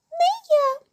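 very high little mouse refusal; high-pitched storybook squeak; squeaky mouse. A narrator's reading. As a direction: pipe it up high like a tiny mouse. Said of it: "пищить круть".

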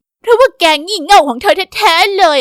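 high, loud furious yell; furious accusation. Acted. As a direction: blast her for the curry. Sara blames Myra, furious.

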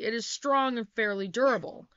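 ordinary delivery

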